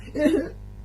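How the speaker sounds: high, short imitated pouting whine; put-on hurt whimper; mock whimper. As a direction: squeal like a fake crier. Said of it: "Like this, right?".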